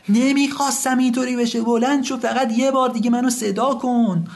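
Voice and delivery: high, anguished shouted plea; crying out. Acted. Son begs his dead father to get up, crying out. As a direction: cry out desperately, pitched far up.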